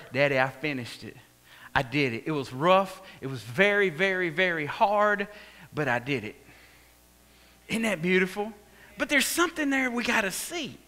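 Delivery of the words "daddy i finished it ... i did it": much lower and softer, tender; tender report. Retold or imitated. Jesus telling his Father he finished it, low and tender. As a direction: tell Daddy gently it is done.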